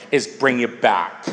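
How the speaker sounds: a soft loving hush. Shown as a demonstration. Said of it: "in a nice, soft, loving way".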